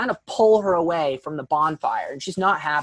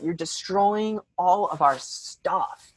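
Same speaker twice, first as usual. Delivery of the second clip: slow, drawn-out mock whine; hammed-up, slowed-down grievance; repeated complaint; 3 dB quieter, slower than usual. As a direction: stretch each word in an imitated lament. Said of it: "She keeps saying that".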